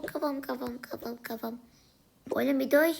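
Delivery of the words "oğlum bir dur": higher, louder annoyed plea; annoyed shout. Acted. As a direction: shout at him to stop. Sibling hurting her head. (sinirle bağırarak)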